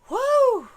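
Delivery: loud high whoop. Real, not acted. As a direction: cheer yourself on with a whoop. Pumping herself up.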